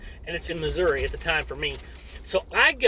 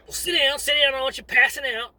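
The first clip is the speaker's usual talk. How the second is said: drill sergeant order; 7 semitones higher than usual. Retold or imitated. Drill sergeant orders recruit to sit.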